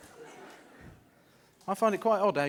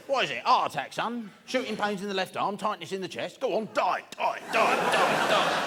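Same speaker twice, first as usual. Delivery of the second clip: higher, excited father-in-law voice; eager father-in-law; 6 semitones higher than usual. Acted. Father-in-law hoping for a heart attack, hammed-up eager urging. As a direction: urge it on, building to a chant. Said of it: "He's like".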